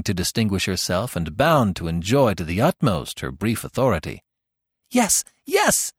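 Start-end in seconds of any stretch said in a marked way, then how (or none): 4.9-5.9 put-on very high, gleeful shout of boys; excited cry. A narrator's reading. The boys cheering for a dinner, excited cry. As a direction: cheer the idea. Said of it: "cried all the boys".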